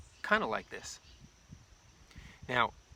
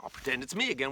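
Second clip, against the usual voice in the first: ordinary delivery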